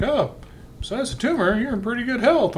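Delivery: high, chirpy mock doctor voice; imitating doctor. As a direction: sing it out like a cheery doctor. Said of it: "when a kid is like".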